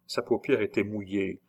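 plain reading voice

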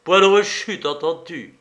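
stern telling-off with a touch of irritation; irritated mentor. A narrator's reading. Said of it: "d'un ton de menteur irrité".

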